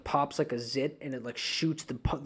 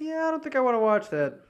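squeamish; 11 semitones higher, 3 dB louder than usual. Real, not acted. Refusing the gross video.

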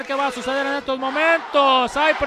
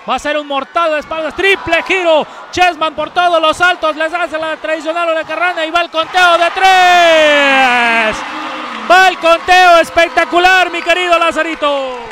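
louder, higher climax call; excited shouting; 3 semitones higher, 6 dB louder than usual. Acted. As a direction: yell the pin like a commentator. Commentator calls the winning pin.

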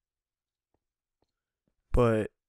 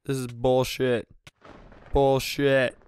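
frustrated; 6 dB louder, slower than usual. Real, not acted. Poisoned in game.

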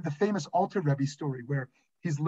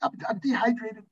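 high-pitched, hammed-up wail of doom; retold panicked moan; mock desperation; 11 semitones higher than usual. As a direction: play up being stranded and dying, higher than usual. Retells being stranded with broken foot.

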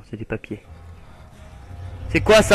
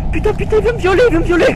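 high-pitched frantic gabbling; real gamer panic; panicked; 11 semitones higher, 4 dB louder, much faster than usual. Real, not acted. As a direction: blurt it out in a panic, voice shooting up. Panics, chased in game.

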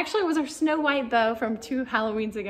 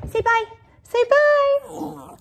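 high, loud, slow coaxing of the dogs; high coaxing call; 13 semitones higher, 7 dB louder, much slower than usual. Real, not acted. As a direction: sing it up to get them to bark.

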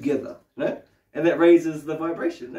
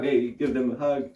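ordinary talk, nothing marked

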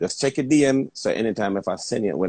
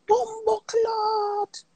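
very high, slow, drawn-out squeal of shock; overwhelmed high wail of surprise; stunned exclamation; 3 dB quieter, much slower than usual. Retold or imitated. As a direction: stretch the curse out in a high, near-crying wail. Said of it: "me say".

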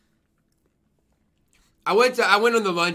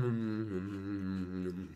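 usual speaking voice

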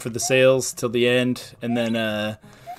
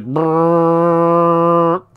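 imitated boat horn; 5 semitones higher, 9 dB louder than usual. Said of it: "And it was like".

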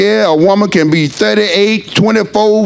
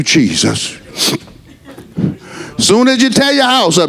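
ordinary talk, nothing marked

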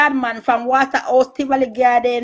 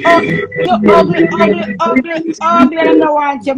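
mocking quote; 5 semitones higher, 5 dB louder than usual. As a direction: sneer it out like he said it. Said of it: "Him say".

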